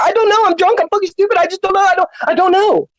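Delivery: high, whiny mock of a dumb drunk; mocking impression. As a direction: squeak it like a pathetic excuse-maker. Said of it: "that's what you're gonna be saying all night".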